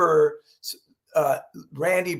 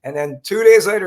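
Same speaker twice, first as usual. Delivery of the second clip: normal talking voice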